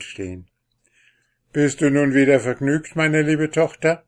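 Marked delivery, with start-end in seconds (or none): 1.5-4.0 higher, louder fatherly question; king asking. Acted. The king asks the princess if she is happy, lightly voiced. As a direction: ask your daughter kindly.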